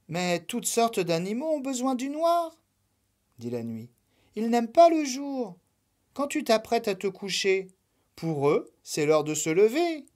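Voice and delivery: lilting voice of the Night; gentle Night. Acted. The Night explains to Lapin.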